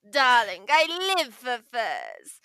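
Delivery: put-on slow, drawn-out smoky older lady; Cruella voice. Shown as a demonstration. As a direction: purr it like a glamorous villainess. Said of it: "an older lady sound, almost like a smoker effect".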